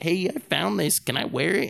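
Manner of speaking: high, eager innocent question. Retold or imitated. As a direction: ask like a hopeful kid. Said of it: "I was like".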